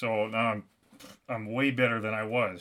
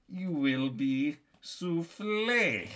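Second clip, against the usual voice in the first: put-on very slow French-accented threat; mock-menacing French accent; 2 semitones higher, much slower than usual. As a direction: stretch it out like a cartoon French villain. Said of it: "in the French accent".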